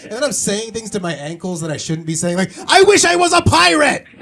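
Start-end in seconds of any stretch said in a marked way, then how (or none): 2.7-4.1 high, loud shout of frustration; frustrated outburst. Acted. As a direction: blurt it out, suddenly yelling. Yelling at ankles.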